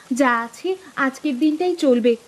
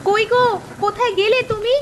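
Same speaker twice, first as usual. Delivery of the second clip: high-pitched, astonished calling out; excited hollering; 8 semitones higher than usual. Acted. Wife calling husband out to see a marvel, excited cries. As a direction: call him urgently, pitch leaping up. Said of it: "চিৎকার চেঁচামেচি করছো".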